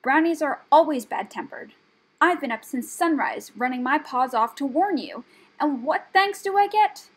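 loud, high aggrieved rant; offended rat's complaint; bristling anger. A narrator's reading. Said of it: "bristling with anger".

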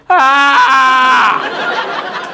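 high, loud squawk like a muffled voice on the phone; imitating Kenny's unintelligible yell down the line; garbled phone shriek. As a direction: screech out a wordless phone noise. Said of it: "as close as I can get to what I heard coming out of the phone".

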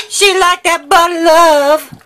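squeaky high put-on voice; high cartoonish cooing over the butter; playful falsetto, loud and silly.

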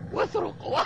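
villain's high cackle; evil laugh. Acted. Pirate chief's evil laugh. (ضحكة شريرة)

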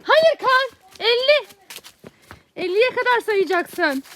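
high loud call, then slow. Real, not acted. Calling out to counting son.

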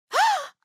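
high, voiced gasp; shocked gasp. Shocked reaction voice line.

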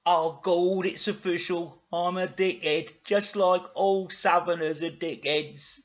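hammed-up slow despair; mock lament. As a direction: wail the admission deliberately. [name] laments his fate.

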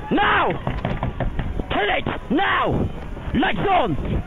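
urgent cox's yell; shouting. Real, not acted. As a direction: yell the crew into a push. Cox calls the crew's push.

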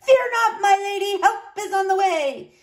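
high, ringing knight's call; heroic call. A narrator's reading. Knight calling to damsel in tower, heroic shout. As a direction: call out grandly to the rescue.

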